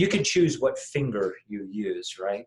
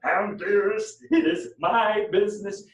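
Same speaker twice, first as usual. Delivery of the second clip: louder, slow, playful lilt; 5 semitones higher, 7 dB louder, slower than usual. Shown as a demonstration. Coach voicing the phrase lightly, playful and slow. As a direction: play with it, not serious. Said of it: "Don't take yourself too seriously".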